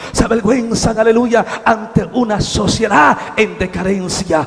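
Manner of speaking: loud, high preacher's cry; full-force preaching. Acted. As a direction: preach it out to the whole church.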